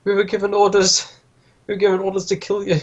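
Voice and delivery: high-pitched frightened henchman; captive spilling the beans. Acted. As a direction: confess in a high character voice. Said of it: "starting to spill the beans".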